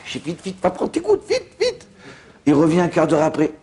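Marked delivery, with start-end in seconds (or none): 0.3-1.9 very high-pitched squeaky hurrying; mock urgent hurrying. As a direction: hurry them along in a comically high voice. Acted urgent hurrying to take drops.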